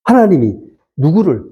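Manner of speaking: ordinary talk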